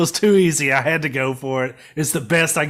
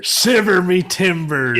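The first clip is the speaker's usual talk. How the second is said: louder, slow mock pirate cry; 3 semitones higher, 9 dB louder, slower than usual. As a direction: bellow it like a cartoon sea captain. Pirate joke, echoing the pirate voice.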